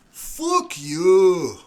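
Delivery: loud, high character yell; in-character shouted insult; defiant shout. Acted. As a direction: hurl it at the captain. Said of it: "Shane looks at the guard captain ... and he goes".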